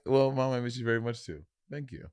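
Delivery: soft, formal imitation of a friend; mock transactional. Co-host mimics friend answering mom, mock transactional. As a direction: imitate a quietly businesslike reply. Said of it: "make it sound so transactional".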